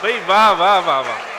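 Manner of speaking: loud, repeated cries of praise; excited acclaim. Real, not acted. Listener applauding the poet's couplet, excited acclaim. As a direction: cheer the verse out loud.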